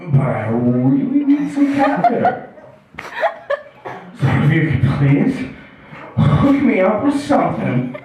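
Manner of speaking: mock contrite pleading; begging and bartering. Addict son apologizes to mom for a favor, pleading. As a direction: ease from apology into a mild plea. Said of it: "it tries to beg and barter".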